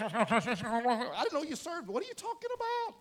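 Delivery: high, loud baffled outburst; exasperated customer. Acted. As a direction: cry out in total confusion at the speaker box. Baffled customer at drive-thru.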